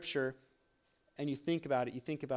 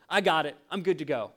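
loud, breezy mock dismissal; mock confident; 7 dB louder than usual. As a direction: toss it off as if done with it. Mock overconfident first reader.

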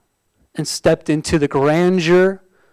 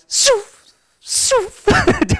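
high, airy mock sound effect; lightly voiced swishing noise; mock whooshing noises. Pastor mimicking a busy lady with silly swishes. Mimics lady's hand gestures.

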